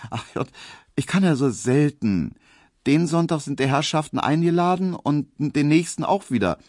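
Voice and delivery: a put-on girlish maid; coy maid Pauline. A narrator's reading. Pauline voiced by narrator.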